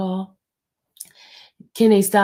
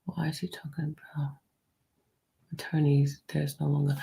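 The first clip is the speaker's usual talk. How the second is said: real unvoiced whisper; 3 semitones lower, 7 dB quieter than usual. Real, not acted. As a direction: breathe the words out with no voice, hoarse sinuses. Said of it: "am I whispering? It's my voice".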